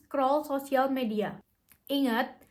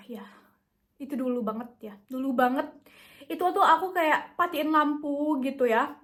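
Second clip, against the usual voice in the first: normal talking voice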